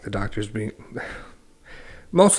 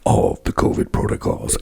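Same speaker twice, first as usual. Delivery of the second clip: close-mic, barely voiced imitation of a DJ; weird DJ voice; 3 semitones lower than usual. Mocking the Emmys DJ announcing covid rules, weird close-mic voice. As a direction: put on a weird voice, lips right on the mic. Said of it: "real close to the mic like this".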